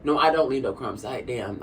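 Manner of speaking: ordinary talk, nothing marked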